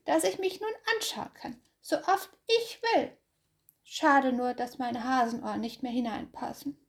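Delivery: high, dreamy hare voice; hare's high thought. Acted. Hase admires himself, high and pleased.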